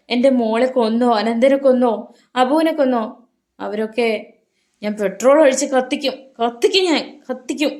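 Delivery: high, loud, raging wail; a mother swearing to burn those who hurt her children; loud frantic threat. A narrator's reading. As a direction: scream the threat in wild grief. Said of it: "അവർ ഉറക്കെ പറഞ്ഞു".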